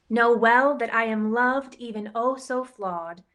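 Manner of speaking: plain reading voice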